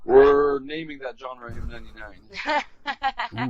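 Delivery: slow, grand reveal; smooth announcement. Pretend. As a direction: announce it like a product launch.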